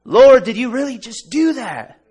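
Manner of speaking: high, loud astonished cry; excited shout to God. Retold or imitated. Preacher shouting his amazement at God. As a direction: cry out to the Lord in disbelief.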